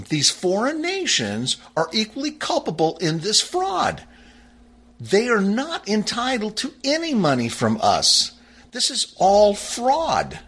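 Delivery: high-pitched, emphatic denunciation; indignant accusation. Real, not acted.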